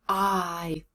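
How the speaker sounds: "I" stretched out as a long diphthong; drawn-out "I" sound; long vowel. Shown as a demonstration. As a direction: hold "I" on its own, slowly gliding. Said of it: "long vowel".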